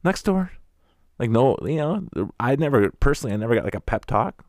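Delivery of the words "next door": high, louder, brusque mock adult; brisk adult. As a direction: wave the kid on, impatient. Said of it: "the adults are just like".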